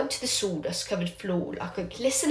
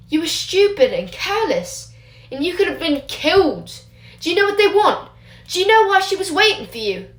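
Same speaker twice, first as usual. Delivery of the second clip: higher and louder angry lecture; furious scolding; 9 semitones higher, 4 dB louder than usual. Acted. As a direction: berate him, rising in fury. Teacher berates Remus.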